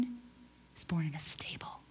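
whisper. Acted. Preacher to congregation on Jesus' humble birth, whispered. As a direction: drop to a secret whisper.

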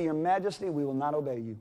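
soft, low and respectful. Retold or imitated. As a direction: refuse the king politely and quietly. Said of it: "They speak with the utmost respect".

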